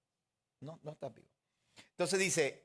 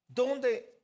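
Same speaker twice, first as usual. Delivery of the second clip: ordinary talk, nothing marked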